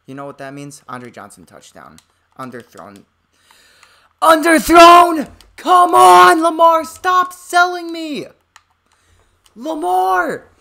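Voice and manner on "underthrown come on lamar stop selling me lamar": high, screaming, drawn-out rage; furious scream. Real, not acted. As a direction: scream at the quarterback. Yelling at his own player.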